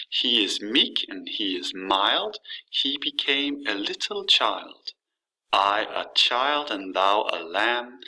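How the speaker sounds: measured verse recital with a regular beat; slowish, rhythmic poetry reading; exaggerated poem rhythm. Shown as a demonstration. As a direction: lean gently on every other syllable. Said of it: "exaggerate just a little bit about how the rhythm".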